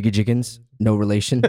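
matter-of-fact name reading at full voice; announcer reading names. Acted. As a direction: announce the name, then tack on the correction. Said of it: "at the advice of someone else".

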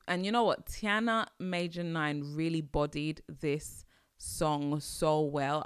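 ordinary talk, nothing marked

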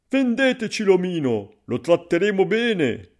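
high, loud buyer's plea; coaxing strangers. A narrator's reading. Strangers coax the farmer. (forestieri insistenti, voce alta)

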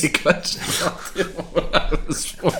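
giggling through it; laughing. Real, not acted. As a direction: laugh at your own mix-up. Laughing at the mix-up.

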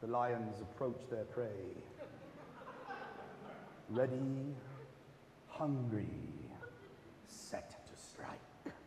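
an English nature documentary voice, quiet and slow; David Attenborough. Retold or imitated. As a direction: murmur it like a wildlife film voice, fading to a whisper. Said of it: "the voice of, like, David Attenborough".

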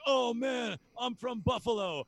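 high, loud gushing sports fan; excited fan. Retold or imitated. Stranger in a store greeting him as an excited fan. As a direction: play the fired-up stranger who spots your jersey. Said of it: "people would come up to me in stores and be like".

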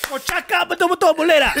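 high, loud, laughing mock telling-off; teasing friend in Malay, mock scold. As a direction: scold him like an auntie, laughing.

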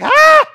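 high, loud mock shriek; a sudden yelp of shock; startled cry. Witch sees someone coming up, startled cry. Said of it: "all of a sudden, she just sees this person".

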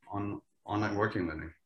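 normal talking voice

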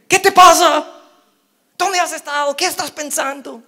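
high, loud scolding questions; exasperated parent scolding. Retold or imitated. Relieved parent scolding the lost child, high loud questions. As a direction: scold the kid high and loud, fading at the end.

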